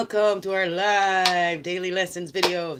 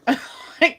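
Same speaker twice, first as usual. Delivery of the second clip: laugh; 9 semitones higher, 6 dB louder than usual. Real, not acted. Laughs at own joke.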